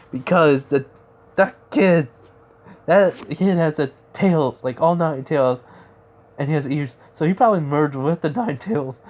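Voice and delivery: acted-out agitation, voice pushed up and loud; alarmed explanation. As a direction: insist on it with rising alarm. Alarmed character explains the retreat.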